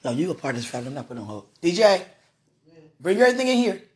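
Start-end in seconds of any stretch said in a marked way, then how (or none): 1.6-3.8 loud, higher call across the house. Real, not acted. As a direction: shout for him from the table. Calling out to another room.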